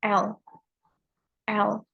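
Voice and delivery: lateral L sound said alone; L sound demo. Shown as a demonstration. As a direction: model the English L, then repeat it. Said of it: "which is the L sound".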